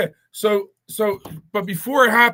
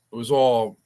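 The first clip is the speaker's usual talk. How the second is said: usual speaking voice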